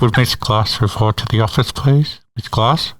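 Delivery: muffled PA announcement; over the intercom. Retold or imitated. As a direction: page a teacher as if through a school PA. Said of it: "fake announcements over the P A system".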